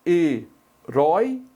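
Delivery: He-rói-co split slowly into syllables. Shown as a demonstration. Teacher slowly splitting Heróico for students. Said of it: "como é que eu separo?".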